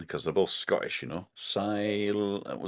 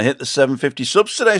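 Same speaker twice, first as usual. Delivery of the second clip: excited read-out; 7 semitones higher, 10 dB louder than usual. Retold or imitated. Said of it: "says Alan".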